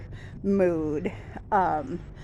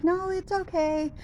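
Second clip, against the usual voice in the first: much higher, chirpy customer service lady; high sweet service voice; 3 semitones higher than usual. Retold or imitated. Customer service lady reassuring a caller, in a high chirpy voice. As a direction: imitate the cheerful phone rep brushing it off. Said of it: "And she's like".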